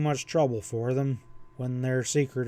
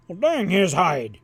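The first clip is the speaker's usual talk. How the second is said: loud, higher forceful exclamation; forceful outburst; 5 semitones higher, 5 dB louder than usual. A narrator's reading. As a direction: blurt it out forcefully. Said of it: "ejected forcefully".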